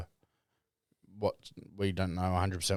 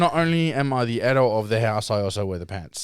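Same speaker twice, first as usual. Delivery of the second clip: smug boasting; 4 semitones higher, 10 dB louder than usual. Real, not acted. Boasting over jar win.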